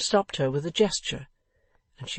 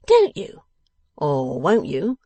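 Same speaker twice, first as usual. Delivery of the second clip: sarcastic challenge; 9 dB louder, slower than usual. Acted. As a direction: throw his denial back at him. Boffin challenges secretary's denial.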